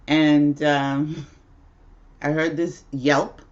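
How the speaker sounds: normal talking voice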